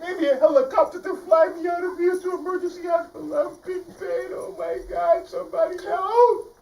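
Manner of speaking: very high, loud melodramatic panic; lion overreacting to the pain; hysterical lion. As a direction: wail for help in mock agony. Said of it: "The lion said".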